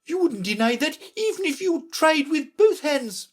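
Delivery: very high-pitched, imperious Red Queen; impatient scolding. A narrator's reading. Red Queen interrupting Alice, high and impatient. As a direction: cut in and lecture from the top of the voice. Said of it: "interrupted her impatiently".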